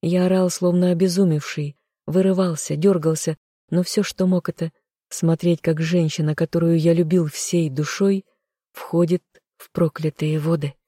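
ordinary delivery

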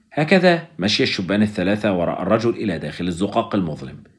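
ordinary delivery, nothing marked

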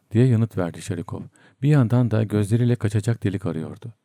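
plain reading voice

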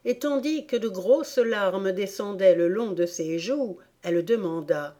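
ordinary delivery, nothing marked

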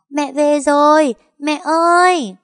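put-on high, loud, drawn-out child's call. Little boy calling his mother home, loud high call. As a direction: shout happily across the yard to mom. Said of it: "gọi to".

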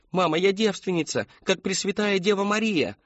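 very high, insistent plea; desperate protest. A narrator's reading. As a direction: plead innocence to your mother, high-pitched. Daughter protests innocence to mother.

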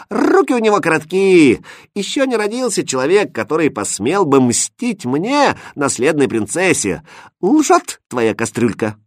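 high, haughty outburst; indignant exclamation. A narrator's reading. Princess rejects the pot's threat, exclaiming indignantly. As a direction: pitch it up in royal outrage. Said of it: "воскликнула принцесса".

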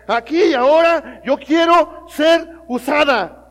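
Aquí y ahora yo quiero ser usada, loud and slow; bold prayer cry. Retold or imitated. Girl on the street crying out loud to God. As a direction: cry it out to God, stretching each word.

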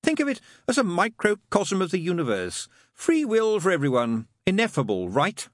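high, insistent demon persuading; demon making his case. Acted. Crowley arguing free will to Aziraphale, insistent.

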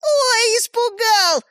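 very high, jeering fake fright; mocking. A narrator's reading. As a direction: taunt with pretend alarm. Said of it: "насмешливо раздалось из-за двери". (насмешливо)

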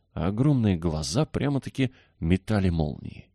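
ordinary delivery, nothing marked

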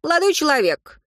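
put-on grand, theatrical old schemer; mustache twirling villain. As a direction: exclaim it with pompous pathos, rising high. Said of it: "пафосно воскликнул старичок". (пафосное восклицание злодея-старика)

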